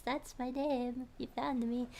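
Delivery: ordinary talk, nothing marked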